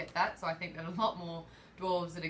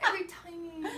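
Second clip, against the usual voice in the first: higher, pleading fan voice; 8 semitones higher than usual. Pretend. Fan begging George in a higher pleading voice. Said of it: "It's fan voice".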